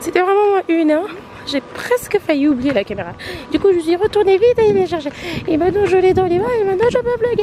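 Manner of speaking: giddy rush of excitement; excited. Real, not acted. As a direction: burst back in all wound up after nearly forgetting the camera. Rushing back to grab camera.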